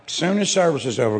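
ordinary talk

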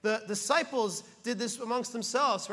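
ordinary delivery

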